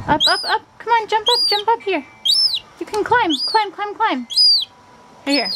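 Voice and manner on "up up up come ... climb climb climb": high, sing-song chant to climb; excited coaxing of the pet; eager urging. Real, not acted.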